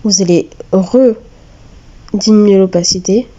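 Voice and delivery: normal talking voice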